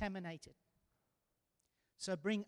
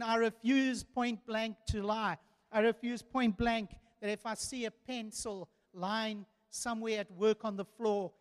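firm loud refusal; 3 semitones higher, 8 dB louder than usual. Retold or imitated. Preacher voicing Joe's firm refusal.

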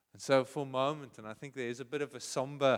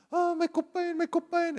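put-on much higher, loud, wailing little boy; hysterical child; 8 dB louder than usual. Small son wailing to his mother about his sore head. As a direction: wail it like a hurt, panicking kid. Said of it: "this one is so hysterical".

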